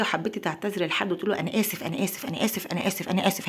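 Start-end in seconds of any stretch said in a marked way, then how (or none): 1.4-3.5 rote repeated apology. Shown as a demonstration. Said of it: "هيقبل اسفك؟".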